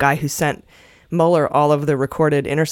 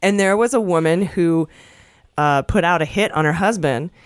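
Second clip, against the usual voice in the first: ordinary delivery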